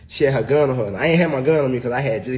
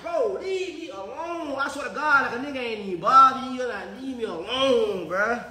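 whiny plea; 9 semitones higher than usual. Real, not acted.

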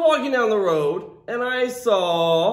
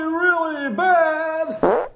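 drawn-out disgust; 7 semitones higher, much slower than usual. Acted. Exaggerated stinky emphasis.

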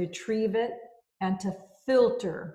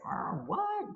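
confused; 13 semitones higher, 5 dB quieter than usual. Retold or imitated. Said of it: "I was like".

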